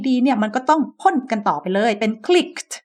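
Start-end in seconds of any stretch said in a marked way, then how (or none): 2.3-2.7 "clicked" ending in an airy t right after k; puffed final t. Shown as a demonstration. Teacher shows the ed rule, puffed final t. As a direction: puff a t onto the end of "clicked". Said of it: "เราจะพ่นลมตามออกไปทันที".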